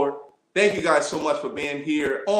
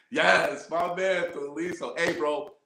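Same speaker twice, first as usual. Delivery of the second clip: ordinary talk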